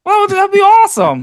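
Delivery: high, joking fake enthusiasm; mock excited. Real, not acted. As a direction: toss it off with a playful grin. Joking back at the jab.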